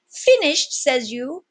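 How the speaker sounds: very high, loud incredulous cry; indignant disbelief. Acted. Pirate scoffing at the captain, high and loud.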